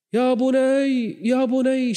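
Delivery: high, very slow pleading call; father calling out. Acted. Noah calling his drowning son, drawn-out plea. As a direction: stretch it out, calling across the water.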